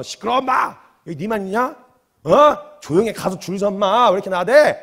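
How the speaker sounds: acted gruff telling-off; irritated bystander's rebuke, bursts of loudness; scolding to silence. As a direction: shut down a noisy troublemaker. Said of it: "주변 사람들이 말리기 시작했어요".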